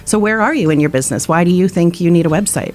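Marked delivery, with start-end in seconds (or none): none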